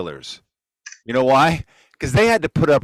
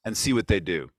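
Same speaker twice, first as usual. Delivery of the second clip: usual speaking voice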